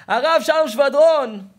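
slow, high full-voiced shout; drawn-out preacher's cry; proclaiming shout. Real, not acted. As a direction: thunder the name out to the hall. Preacher proclaims the rabbi's name.

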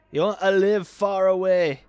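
a lightly put-on Scottish accent, loud on the question; voicing a colonist with a Scottish accent, loud at first. To a colonist asking to live far away, playful Scottish accent. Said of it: "I just made 'em all Scottish".

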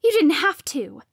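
high, loud, bitter retort; spat. A narrator's reading. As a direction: spit the words at her. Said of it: "she spat".

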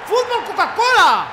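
drawn-out, high celebratory scream; grito de gol. Real, not acted. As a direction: scream it slowly in triumph. (grito de gol eufórico y alargado)

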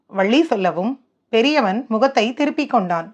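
ordinary delivery, nothing marked

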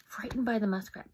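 ordinary delivery, nothing marked

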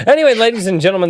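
high, fast showman's opener; host announcement. Acted. Show host introduction.

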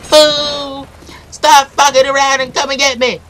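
high, loud challenge; loud taunt. Acted. Player daring rival to come, loud high-pitched taunt. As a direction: call them out, high and loud.

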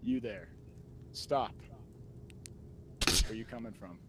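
low, stern guard challenge; Skyrim NPC voice. Pretend. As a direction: stop a passerby like a game guard. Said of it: "give me some Skyrim N P C".

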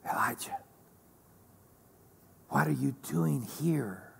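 breathy whisper, almost no voice. Shown as a demonstration. Preacher voicing God's soft whisper to Elijah, whispering. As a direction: lean in and whisper it close. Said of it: "to hear the whisper of God".

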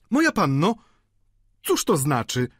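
landlady's high exclamation; exclaiming. A narrator's reading. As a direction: cry out in indignant surprise. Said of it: "zawołała pani Wauquez".

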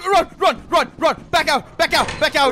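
loud frantic shouting; urgent yelling to flee; panicking gamer. Real, not acted. Fleeing the monster.

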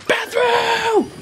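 high, drawn-out squealed yell; playful high-pitched whoop; sudden silly shout. Pretend. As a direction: burst out in a high holler. Sudden yell after plain remark.

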